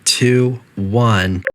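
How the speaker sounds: calm, even automated announcement voice; a lightly voiced facility intercom; facility announcer. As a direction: read it like a polite recorded PA system.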